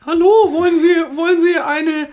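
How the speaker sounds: high, put-on saleswoman pitch. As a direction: chirp it like a pushy beauty seller. Mocking YouTuber cosmetics seller.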